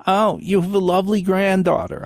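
sweet imagined compliment; mock stranger. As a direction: coo it like a kindly stranger.

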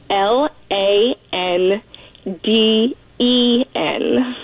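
slow, letter by letter; unhurried spelling, letter after letter; spelled out. Real, not acted. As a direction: spell it out slowly with each letter separate. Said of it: "Spell your last name".

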